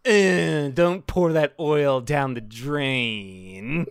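loud whine, then slow falling drawl; slow, sagging robot whine; whiny robot powering down. Acted. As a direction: groan in, then wind down slowly to a stop. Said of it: "powering down at the every end of every sentence".

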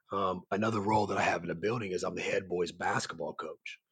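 ordinary talk

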